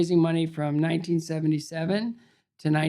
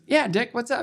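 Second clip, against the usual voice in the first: casual acted reply; 7 semitones higher than usual. Roone answers Dick Button.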